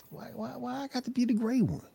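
high, soft whine; put-on high-pitched pleading; mock whining. Panel guest jokingly complaining about being gray Ranger, mock whining.

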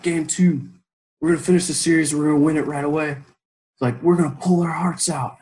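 loud, high rallying shout; war speech. Retold or imitated. To teammates over the duck, a loud rallying shout. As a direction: fire up the troops before battle.

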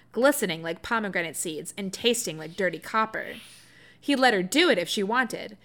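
ordinary talk, nothing marked

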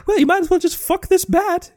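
very high, fast squeaky cartoon mouse; Mickey Mouse voice. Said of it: "And Mickey's like".

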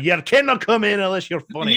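loud, high, ferocious Scottish brogue; full-chest Scottish accent. Acted. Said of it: "one of those full chest kinda accents".

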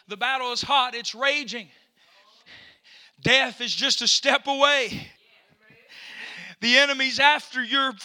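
ordinary talk, nothing marked

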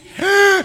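loud, high imitated gasp; mock gasp. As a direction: gasp as if jolted by brakes. Acts out the sudden braking.

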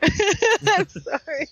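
high, slow, laughing-crying sorry; teary apology. Real, not acted. Host apologizing to cohosts, high and tearful. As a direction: apologize through tears and laughter. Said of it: "now I am crying".

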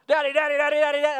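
high, loud string of repeated calls; imitated pestering child. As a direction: pester Dad like an excited little kid. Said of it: "then I'll just hear".

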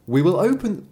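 put-on high, piping kid goats; high-pitched children's chorus; little kids. Narrator voices the little kids.

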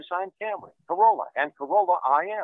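recorded ad over phone; phone hold ad. Real, not acted.

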